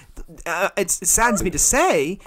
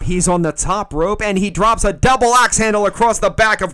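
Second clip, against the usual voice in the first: lightly voiced ringside announcer; mock play-by-play commentary; wrestling commentator; 4 semitones higher, 7 dB louder than usual. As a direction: call the match like a TV wrestling host.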